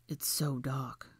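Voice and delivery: slow, soft murmur; hushed awe. Acted. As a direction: draw it out quietly, taking in the dark. Awed at total darkness.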